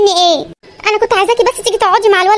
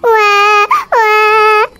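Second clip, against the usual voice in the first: put-on baby wailing; much slower than usual. Crying baby voiced.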